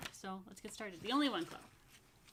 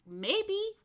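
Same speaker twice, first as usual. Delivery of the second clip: coy maybe; 12 semitones higher, 6 dB louder than usual. A narrator's reading. Jonah coy reply.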